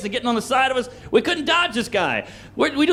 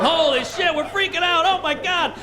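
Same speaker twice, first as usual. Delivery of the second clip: high-pitched mock panic; acted-out squealing fright, much higher; screaming like schoolgirls; 6 semitones higher than usual. Teens trapped in their car, screaming like schoolgirls. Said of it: "screaming like, like little schoolgirls".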